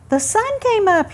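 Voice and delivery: high, bright little squirrel voice; chirpy squirrel. Acted. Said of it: "he chirped".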